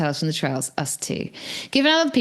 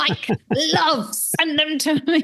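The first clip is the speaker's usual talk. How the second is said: doting coo; 8 semitones higher than usual. Retold or imitated. Cooing over dog photo.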